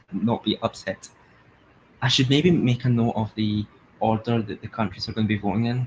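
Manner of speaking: ordinary delivery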